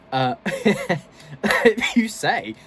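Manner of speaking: words squeezed out through laughter; laughing. Real, not acted. Laughing at the politician clip.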